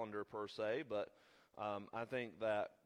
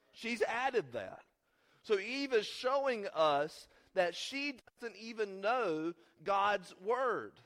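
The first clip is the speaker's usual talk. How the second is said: raised, slow preaching delivery; 8 semitones higher, 7 dB louder, slower than usual. Real, not acted. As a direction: stretch out the key words to drive the point home.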